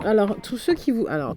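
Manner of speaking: ordinary talk, nothing marked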